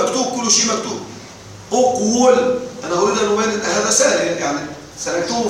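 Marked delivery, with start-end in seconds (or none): 1.7-2.6 "أقول" as uq-wul, higher, repeated; underlying form. Shown as a demonstration. Teacher modelling the original on the pattern of uktub, split form. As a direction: say "أقول" in two parts, q then a sounded w. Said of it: "الأصل".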